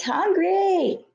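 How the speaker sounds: high shrieked wail; shrieking. A narrator's reading. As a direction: shriek it, then let it fall. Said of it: "you shrieked".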